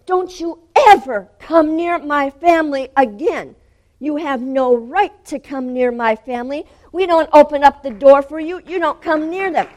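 loud, high-pitched furious warning; fierce, protective rebuke at full force; authoritative shouting. Retold or imitated. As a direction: shout them away from your family. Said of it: "I'm shouting at him".

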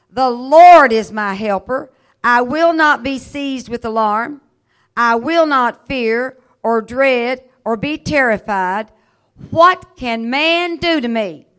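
loud, slow, ringing scripture proclamation; preaching at full force. Acted. Preacher booming the psalm to his congregation. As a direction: proclaim it to the whole room.

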